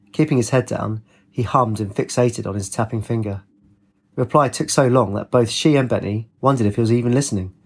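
ordinary delivery, nothing marked

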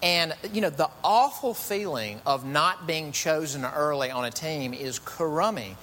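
usual speaking voice

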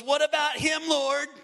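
loud, high challenge; Peter pointing at John. Retold or imitated. Peter pointing at John, loud jealous demand to Jesus. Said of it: "he points at John and he goes".

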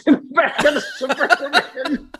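words breaking into high laughter; real laughing. Real, not acted. [name] recalling the ride in the Suburban, real laughing. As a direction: crack up halfway through the line. Cracks up at his own story.

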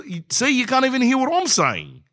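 exasperated audiologist. Retold or imitated. The audiologist, exasperated with the deaf patient, high and fed up. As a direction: play the fed-up audiologist who has run out of patience. Audiologist mocks his hearing.

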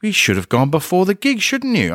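high, sing-song mock reproach; joking telling-off. Model blocks his way back from the toilet, joking reproach. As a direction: tease him like a scolding teacher. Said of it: "jokingly, like, she was having a laugh".

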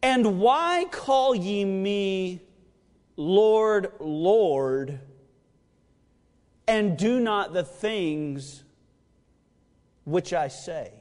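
slow, solemn reading of the verse; measured, reverent preaching voice; scripture reading. Acted. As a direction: read the words of Jesus with weight.

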